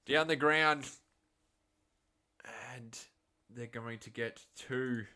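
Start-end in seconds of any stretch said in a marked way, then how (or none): 0.1-0.9 loud, raised call of the shot; excited call. Real, not acted. Commentator following a shot down the ground, loud call. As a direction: punch it out as the ball flies.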